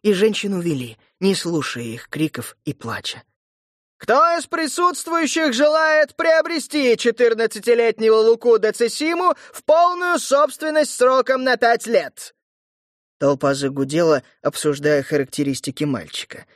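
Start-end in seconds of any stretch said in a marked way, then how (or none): 4.0-12.4 loud auction call; town crier. Acted. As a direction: call out to the crowd. Herald auctioning boy to crowd.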